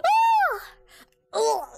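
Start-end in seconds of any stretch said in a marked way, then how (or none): 0.0-0.6 very high, loud terrified scream; shrill scream. Real, not acted. Player caught by the killer, shrieking in terror. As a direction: let out a piercing shriek.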